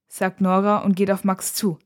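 ordinary delivery, nothing marked

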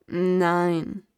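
tiefes, leises Brummen; mürrisch brummen; brummig. Acted. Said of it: "Kasta brummte". (brummender alter Baum)

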